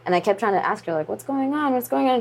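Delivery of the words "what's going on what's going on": high, insistent retold questions; mildly alarmed, pitched up; anxious questioning. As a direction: re-enact pressing mom for an answer. Retelling anxious questions to mom.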